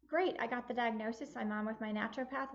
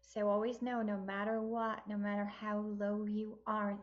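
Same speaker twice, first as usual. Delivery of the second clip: ordinary talk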